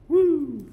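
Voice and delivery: high, loud "woo"; playful whoop. Real, not acted. Teacher whooping over wild nerve cells, playful whoop. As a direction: let out one long, high woo.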